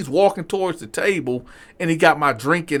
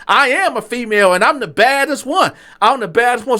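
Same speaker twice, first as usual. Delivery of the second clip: imitated defiant waiter, pitched high; sassy boast; 8 semitones higher, 4 dB louder than usual. Waiter answering being told he acts female, sassy boast. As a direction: proclaim it high and proud.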